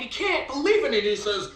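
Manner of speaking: ordinary delivery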